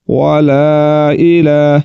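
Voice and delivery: "وتبارک" drawn out slowly, chanted syllable by syllable; slow recitation. Shown as a demonstration. Teacher modelling the recitation of "وتبارک". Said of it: "teacher reads the text slowly".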